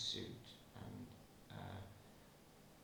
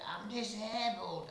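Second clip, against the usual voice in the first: the wrong take, pitched up; high squeal; 11 semitones higher, 6 dB louder than usual. Shown as a demonstration. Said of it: "I didn't say it like that".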